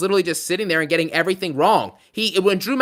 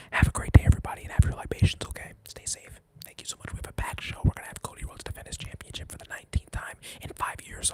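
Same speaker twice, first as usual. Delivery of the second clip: hushed whisper, almost nothing; whispered sign-off; 7 dB quieter than usual. Pretend. Streamer jokingly signs off to chat in a whisper. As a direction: whisper the goodbye like a secret.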